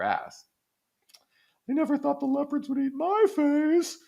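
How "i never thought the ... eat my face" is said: very high, squeaky mock wail; mock lament. Quoting a famous tweet as its hapless victim, high mock lament. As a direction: put on a high, silly victim voice. Acted quote of a well-known tweet.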